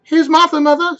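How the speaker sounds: put-on high, loud young girl's voice; girl calling. Martha announces herself to her mother, high and loud. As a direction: call out brightly to mother. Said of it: "said the girl".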